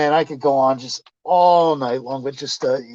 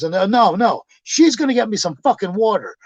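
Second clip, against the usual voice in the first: raised-pitch indignant refusal; retold stubborn insistence; 7 semitones higher than usual. Customer insisting the clerk give him water, indignant. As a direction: mimic him digging in his heels.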